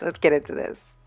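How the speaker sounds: normal talking voice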